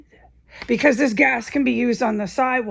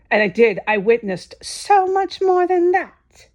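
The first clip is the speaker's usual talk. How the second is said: heated insistence; 7 semitones higher, 3 dB louder than usual. Real, not acted. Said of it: "I'm getting pissed off".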